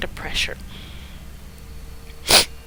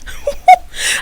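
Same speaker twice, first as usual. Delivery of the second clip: high, gleeful cackle mid-threat; a high, delighted cackle inside the threat; gleeful laugh; 10 dB louder than usual. Acted. Laugh during the menacing character line.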